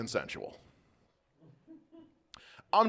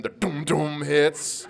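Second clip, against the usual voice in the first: imitated theme sting; 3 semitones higher, much slower than usual. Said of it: "Law and Order music starts playing".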